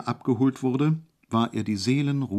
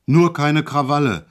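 louder, sudden bellow; roaring; 4 semitones higher, 6 dB louder than usual. A narrator's reading. Guard suddenly bellowing at Hejula in the car. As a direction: bark a sharp warning. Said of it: "brüllte er auf einmal los".

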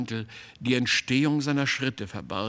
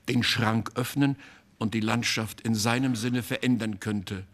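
usual speaking voice

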